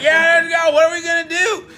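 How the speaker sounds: high, loud mock cheer of the crowd; hyped crowd. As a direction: shout it like a pumped-up audience. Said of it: "They were like".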